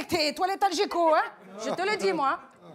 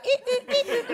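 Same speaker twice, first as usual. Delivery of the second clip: mock squeaky walk noise; 7 semitones higher than usual. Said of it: "Il marche comme ça".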